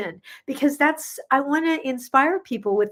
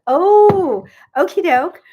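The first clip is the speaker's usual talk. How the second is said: surprised delight; 8 semitones higher, 10 dB louder, slower than usual. Real, not acted. Reacting to video idea.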